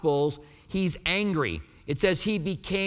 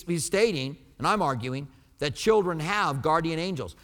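ordinary talk, nothing marked

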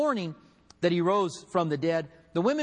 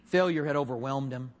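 ordinary delivery

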